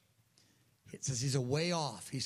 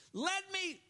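very high, strained cry of confession; hammed-up desperate begging, far above the usual pitch; pleading son. Son's plea is cut off.

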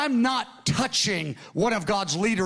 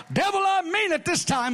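mock earnest; 11 semitones higher than usual. Mocking over-earnest deliverance.

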